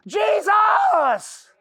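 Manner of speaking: high, loud cry of the name; desperate scream. Retold or imitated. A dying sinner screaming out to Jesus, desperate. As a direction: scream it with the last breath.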